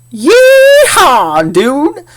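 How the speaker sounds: high, loud southern holler; southern yeehaw. Acted. Teacher hollering as Farmer James McPoor, southern whoop. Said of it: "kinda be a little southern accent".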